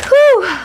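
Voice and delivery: high exhale after laughing; relieved whew. Real, not acted. Host catching her breath after laughing, high whew.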